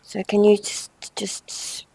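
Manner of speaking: hushed whisper to the cat, barely voiced. Real, not acted. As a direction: coax softly so the cat stays calm.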